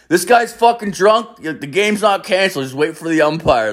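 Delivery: high-pitched mock shout to the field; retold holler, pitched well up; imitated calling out. Owner corrects announcement to the players.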